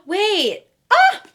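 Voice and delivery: high gasping shriek; shocked gasp. Real, not acted. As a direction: gasp at the cliffhanger. Shocked by cliffhanger.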